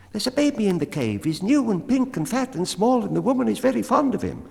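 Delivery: very high, fast squeaky bat voice; tiny creature rushing out the news; the bat, chattery. A narrator's reading. Bat hurries to tell the cat about the baby, high and fast.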